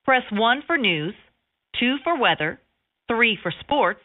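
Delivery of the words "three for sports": ordinary talk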